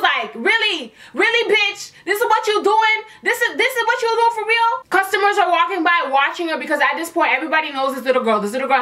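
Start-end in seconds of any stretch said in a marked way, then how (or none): 0.3-4.9 high-pitched incredulous indignation; mock outraged challenge, pitched up; pissed off, imitated. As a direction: act out disbelief at someone's nerve. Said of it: "She was pissed off".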